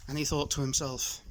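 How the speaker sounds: usual speaking voice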